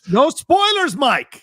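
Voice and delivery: mock alarmed yell. Real, not acted. Co-host yelling at listener.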